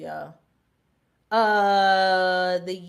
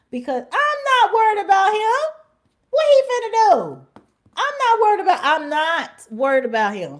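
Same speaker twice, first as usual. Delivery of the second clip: much higher and louder, scoffing bravado; high mocking coworker; 9 semitones higher, 6 dB louder than usual. Coworker scoffing about the fired man, high and loud. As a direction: toss it off, unafraid of him. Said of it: "she was like".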